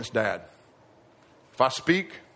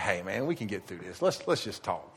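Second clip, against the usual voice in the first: hushed, much softer, buddy-buddy cop; soothing good cop; 6 semitones lower, 8 dB quieter than usual. Retold or imitated. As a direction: lean in and coax him quietly. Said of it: "And he's like".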